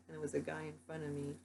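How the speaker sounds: ordinary talk, nothing marked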